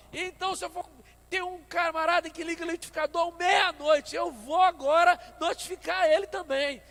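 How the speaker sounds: high, loud, indignant tirade; retelling his own angry outburst at the door; nervous loud rant. Retold or imitated. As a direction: rant so the downstairs neighbor hears. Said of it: "comecei a ficar nervoso e comecei a falar alto".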